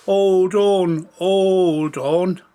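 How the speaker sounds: loud, drawn-out giant's boom; big booming storybook giant. A narrator's reading. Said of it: "With a booming voice".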